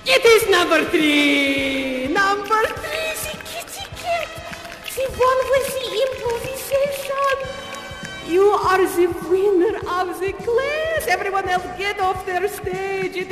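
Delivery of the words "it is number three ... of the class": high, stretched-out excited announcing; triumphant winner announcement. Acted. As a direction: proclaim the winner with theatrical glee.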